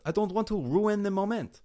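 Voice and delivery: high-pitched, hammed-up French accent; bad French accent. Podcaster mimicking people's excuse, over-the-top French accent. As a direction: croon it like a cartoon French skunk. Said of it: "I don't know why French".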